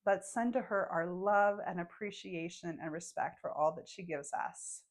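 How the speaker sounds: calm guide voice, letting each phrase settle; gentle meditation voice; guided meditation. Acted.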